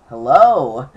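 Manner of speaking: calling again into a silent phone line. Retold or imitated. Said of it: "And I said it again".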